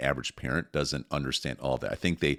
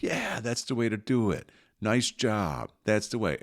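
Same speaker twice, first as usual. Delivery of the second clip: cheering praise; 6 semitones higher, slower than usual. Retold or imitated. Family praising toddler.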